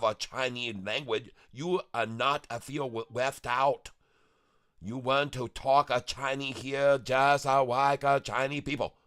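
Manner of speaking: lightly put-on broken-English Chinese accent, clipped grammar; a silly character bragging about speaking Chinese; mock Chinese accent.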